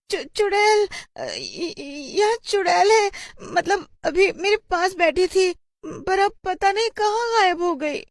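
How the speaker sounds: high, stammering, near-tearful panic; terrified schoolgirl. Acted. Kajal tells teacher a witch sat beside her, terrified.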